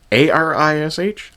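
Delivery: slow mock spelling of how she says Irish; spelling out the mocked accent. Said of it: "I'm making fun of her accent".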